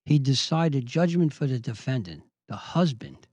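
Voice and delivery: low, softening mock humility; lightly imitated phony humble voice. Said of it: "humble voice".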